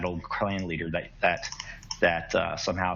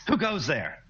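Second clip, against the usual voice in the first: startled call; 9 semitones higher than usual. Acted. Startled captain calls out.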